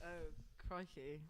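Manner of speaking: soft mock-Australian exclamation; joke Australian accent. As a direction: murmur it in put-on Australian. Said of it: "do an Australian accent all the time".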